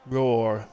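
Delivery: short half-hearted roar; mock roar. Streamer grudgingly doing an animal noise for chat. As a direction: give a lazy little lion roar. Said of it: "not doing any more animal noises".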